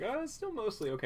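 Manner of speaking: high, rushed mock reassurance; strained optimism. Film viewer clinging to hope as it crumbles, strained optimism. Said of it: "and it's like".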